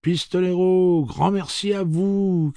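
high, loud woman calling her thanks; woman's cry. Acted. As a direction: call out gratefully over the crowd. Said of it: "cria une femme".

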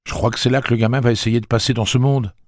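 voix plus haute et plus forte, rapide; Eddie inquiet. Acted. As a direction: parle vite, sous l'angoisse. (Eddie prévient Roland, inquiet et pressé)

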